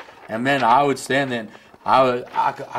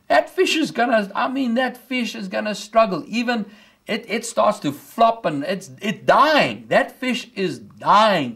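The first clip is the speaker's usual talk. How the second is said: pitched up, building sermon fervour; rising preaching; 6 semitones higher than usual. Acted. Preacher building to the dying fish, pitched high. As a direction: climb into the fish story with mounting fire.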